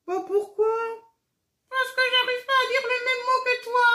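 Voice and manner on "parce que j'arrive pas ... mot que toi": puppet voice. Acted. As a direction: greet the children as an excited, giggly puppet. Marionnette explique sa peine. (voix aiguë et lente de marionnette joyeuse)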